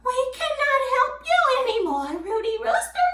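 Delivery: high, quick cat voice; prim refusal sliding up into meows; cat character. Acted. Cat refusing to help the rooster, high cat voice. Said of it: "Kathy's cat says".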